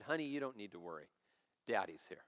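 put-on calm an upset child, quietly and low; reassuring father. Father soothing his upset daughter.

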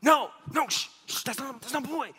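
high, hushed frantic shushing; urgent stage whisper, mock panic; panicked hushing. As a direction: act out a panicked dad shushing his kid. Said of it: "And I panicked".

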